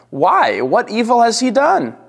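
high-pitched, challenging questions; incredulous protest. A narrator's reading. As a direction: protest as Pilate, pitch leaping up. Pilate protests to the crowd.

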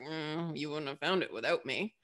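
a low, quiet, bitter aside; lightly voiced bitter know-it-all; bitter projector. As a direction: sulk it under your breath. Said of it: "the projector's like".

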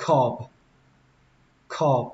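Cob closing on a b; final B sound. Shown as a demonstration. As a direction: end "Cob" with the lips closed on b. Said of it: "Kết thúc bằng âm bờ".

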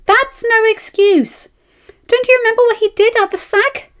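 wife furious at her reckless husband, shouting high; furious scolding wife. A narrator's reading. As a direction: tear into him for risking his life.

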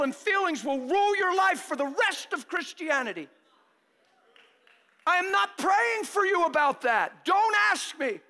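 loud, high-pitched pulpit preaching; preaching at full force. Acted. Preacher pressing the church on flashbacks, full-force preaching. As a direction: drive it home to the congregation.

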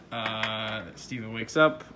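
ordinary delivery

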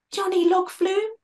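woozy high voice, straining to recognise him; high, woozy wounded knight calling a name; dazed, high questioning call. Acted. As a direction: call his name as if half-fainting. Dazed Betty recognises flag bearer.

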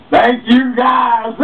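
high, loud mocking thanks; high taunting shout of thanks; sarcastic shout. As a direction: yell a gloating thank-you. Mocking thanks after robbery.

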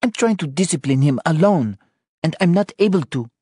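high, strained complaint; exasperated. Acted. Anna disciplines Sean alone, complaining in frustration. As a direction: protest at him, speeding up at the end.

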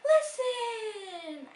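dramatic call. Acted.

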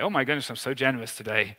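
ordinary talk, nothing marked

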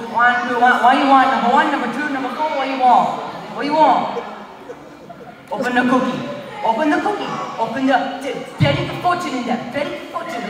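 choppy Chinese-accented English. Shown as a demonstration. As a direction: count and question in a Chinese accent. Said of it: "East Indian, Chinese".

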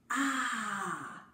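A, long, open and clear; vowel A. Shown as a demonstration. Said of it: "Eh bien lui, il fait". (voyelle A tenue, bien ouverte)

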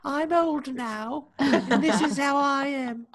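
put-on very high, slow elderly voice; cracking old voice, laughing; old person voice. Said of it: "your old person voice sounds like".

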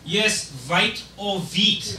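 "Vite or Veet" very slow with V for W; "Vite or Veet" stretched out in an accent; careful accented confirmation. Acted.

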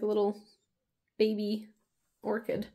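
ordinary delivery

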